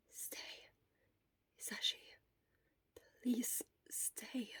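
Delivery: faint unvoiced whisper through a sore throat; vulnerable whisper. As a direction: plead in a fragile whisper. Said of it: "His voice sounded so different, so vulnerable".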